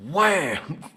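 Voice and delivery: very loud, high shouted wham; punch sound effect. Retold or imitated. Knocking a coworker's glasses off, loud shouted wham. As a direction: belt it out like the punch landing.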